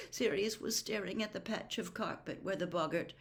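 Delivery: ordinary delivery, nothing marked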